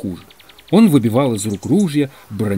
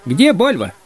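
higher, pressing question; insistent alarm; 7 semitones higher, 3 dB louder, slower than usual. Acted. Манже asking after the missing man, pressing. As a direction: repeat the worried question to the whole group.

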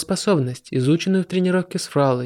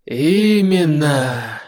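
growled; much slower than usual. Acted. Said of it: "Артмей прорычал".

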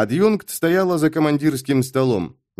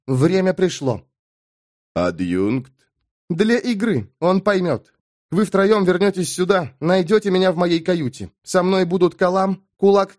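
higher-pitched voice giving orders; commanding woman officer; 5 semitones higher than usual. Acted. Adjunct ordering the high mage to gather men, commanding. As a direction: issue brisk instructions as a woman in command.

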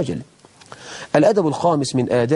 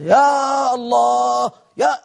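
shouted prayer call; 8 semitones higher, much slower than usual. Retold or imitated. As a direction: bellow يا الله like a showy Ramadan prayer leader. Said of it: "يجي يصلي في رمضان".

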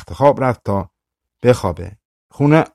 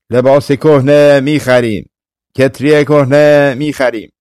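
peddler's call; 3 semitones higher, 5 dB louder than usual. Acted. Street peddler calling wares.

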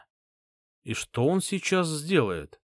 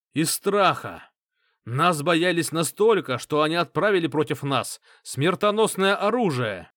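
booming warrior king; 4 semitones higher than usual. A narrator's reading. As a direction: whip the crowd into fury. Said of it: "Голос короля-спасителя становился всё сильнее".

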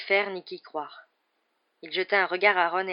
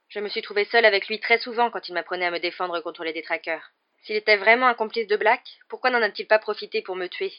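raised, higher voice, building in force; insistent arguing; 4 semitones higher, 8 dB louder than usual. A narrator's reading. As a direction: argue your case heatedly. Harry défend Lupin avec insistance. (argumentation vive et pressante)

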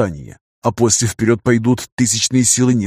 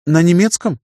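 put-on higher-pitched girlish voice, questioning; girl's question; 7 semitones higher than usual. A narrator's reading. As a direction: ask in a raised young woman's voice. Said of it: "спросила девушка". (девичий голос, удивлённый вопрос)

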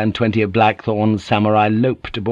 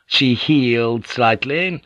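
ordinary delivery

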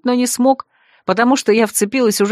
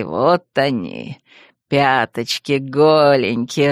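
low, very slow silky threat; drawn-out, low teasing purr; menacing purr; 6 semitones lower, slower than usual. A narrator's reading. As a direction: savour every word like a predator toying with prey. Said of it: "промурлыкал Бер голосом, от которого моё сердце ухнуло".

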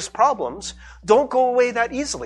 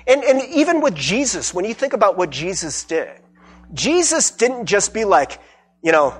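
ordinary talk, nothing marked

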